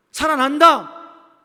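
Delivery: loud, high, drawn-out declaration; booming voice of Jesus declaring life; emphatic proclamation. Acted. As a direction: proclaim it forcefully, stretching the words. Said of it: "예수님은 뭐라고 말해요?".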